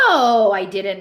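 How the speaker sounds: loud, indignant denial; husband loudly protesting his innocence. A narrator's reading. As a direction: push back hard at the accusation. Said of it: "Nino protested".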